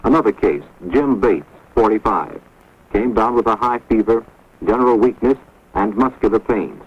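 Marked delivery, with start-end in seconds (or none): none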